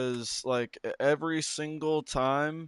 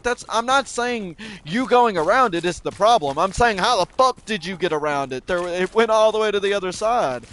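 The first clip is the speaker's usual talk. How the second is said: louder and higher, insisting; heated argument; 5 semitones higher, 4 dB louder than usual. Retold or imitated. Arguing with Sam over the claymore, a louder, higher heated protest. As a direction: push back hard, frustrated.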